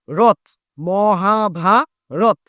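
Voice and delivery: রথ cut short, then Mahabharata stretched very slowly; drawn-out syllables. Shown as a demonstration. Teacher contrasting রথ with stretched Mahabharata, very slow. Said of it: "রথটাকে কিন্তু আমি ten-, টানতে পারছি না".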